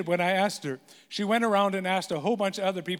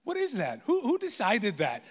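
normal talking voice

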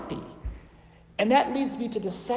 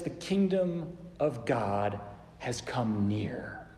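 slow, lower, soft; solemn slow proclamation; 6 semitones lower, 5 dB quieter, much slower than usual. Retold or imitated. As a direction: draw out each word reverently. Jesus' words, slow and low.

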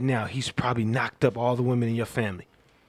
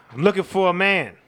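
loud announcement; 6 semitones higher, 6 dB louder than usual. Acted. Calling out in church.

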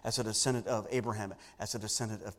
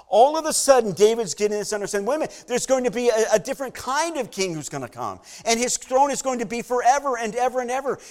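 excited realization; 10 semitones higher, 12 dB louder than usual. Acted. David's sudden realization acted out.

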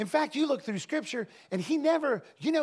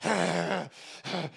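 demon growl; 6 semitones lower, 4 dB quieter, much slower than usual. Retold or imitated. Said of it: "he was like".

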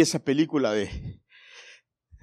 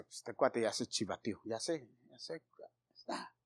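much softer, fading to a whisper; inner aside; 6 semitones lower, 15 dB quieter than usual. Retold or imitated. Pastor recalling his private thoughts in seminary, hushed. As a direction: mutter it to yourself, trailing off.

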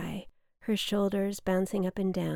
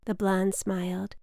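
usual speaking voice

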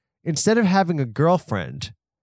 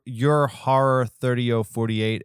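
usual speaking voice

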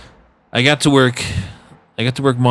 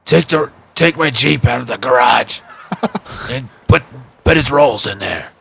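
raspy-voiced manager giving orders; 2 semitones higher, slower than usual. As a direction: rasp out the orders. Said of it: "with his raspy voice".

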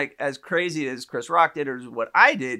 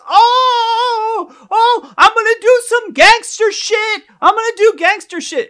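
high, loud shrieking mimicry; imitated scream; 9 dB louder, slower than usual. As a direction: scream it out, mocking an upset man. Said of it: "he screamed and he got up and he goes".

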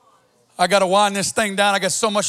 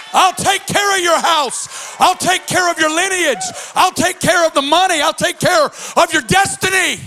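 full-force preaching; 5 semitones higher than usual. Acted. Pastor preaching God's promise to the congregation, shouting. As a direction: build it into a full-throated sermon cry.

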